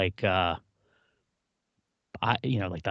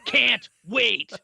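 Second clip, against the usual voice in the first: mock Apple cheer; 10 semitones higher, 5 dB louder than usual. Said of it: "on his knuckles".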